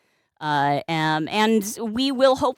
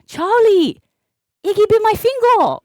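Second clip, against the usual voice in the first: high-pitched playful complaint; mock-hurt whine; 11 semitones higher, 4 dB louder than usual. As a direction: whine loudly about being bitten. Mock complaint after cat bite, quoting video.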